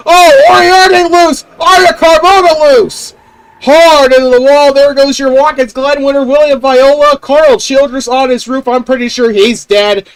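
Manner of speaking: high, loud commentator yelling; excited shout. Real, not acted. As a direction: yell the crash call. Wreck at race start.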